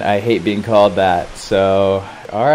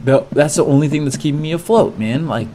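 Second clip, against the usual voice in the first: higher-pitched, pleading refusal; urgent protest; 5 semitones higher than usual. Retold or imitated. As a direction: argue back, mildly panicked. Refusing to remove the strap.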